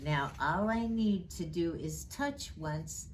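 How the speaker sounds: usual speaking voice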